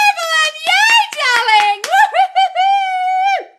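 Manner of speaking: very high, loud whooping cheer; thrilled whoop; ecstatic cheer. Real, not acted. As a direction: squeal with delight for her. Celebrating viewer's news.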